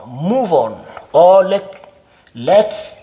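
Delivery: usual speaking voice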